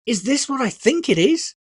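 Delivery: put-on high, eager boy's question; a boy who can hardly believe his present; wild excitement. A narrator's reading. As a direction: burst out with disbelieving joy. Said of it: "he asked with wild excitement".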